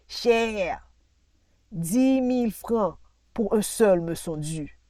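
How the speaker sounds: loud, swelling brag about profits; hammed-up self-important declaiming; boastful planter. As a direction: boom out his successes like a rich landowner. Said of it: "John reprit".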